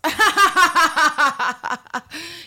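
high, loud burst of laughing; delighted laughter. Real, not acted. Host laughing at a magazine headline, loud happy laugh. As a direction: crack up at the pun.

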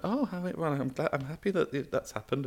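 high, soft flustered mock reply; imitating Nadine. Minister awkwardly answering, high soft flustered mock voice. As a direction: fumble it in a high put-on voice. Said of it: "And she went".

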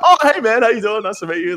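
very high, chirpy greeting; imitating his breezy hello to the stranger; mock casual greeting. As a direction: play it cool but overly cheery. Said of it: "I'm like".

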